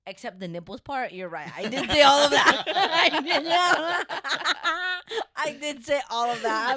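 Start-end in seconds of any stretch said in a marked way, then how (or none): 1.6-6.8 louder, laughing through it; confessing while laughing; laughing confession. Real, not acted. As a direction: admit it while cracking up. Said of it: "I'm gonna just come clean".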